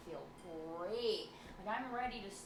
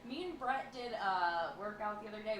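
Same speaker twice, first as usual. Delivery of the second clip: usual speaking voice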